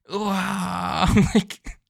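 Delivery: delighted high laugh. Real, not acted. Reviewer laughs at Luffy's face. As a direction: crack up at the moment.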